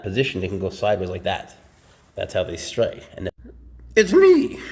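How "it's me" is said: sudden yelp. Real, not acted. Sudden loud burst mid-explanation.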